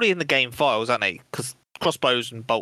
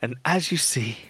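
David Attenborough. Retold or imitated. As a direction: narrate it like a nature film. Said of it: "like a David Attenborough".

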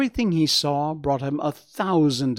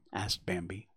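usual speaking voice

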